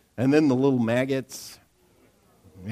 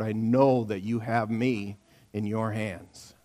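respectful prayer; 4 semitones lower, 4 dB quieter than usual. Shown as a demonstration. Preacher showing the congregation a respectful prayer, softly. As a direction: pray it gently with trust. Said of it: "sounds a little bit something like this".

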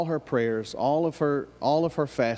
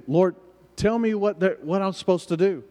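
retold plea, pitched higher; pleading prayer; 5 semitones higher than usual. Wife asks the Lord for direction, retold pleading. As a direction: appeal upward for an answer.

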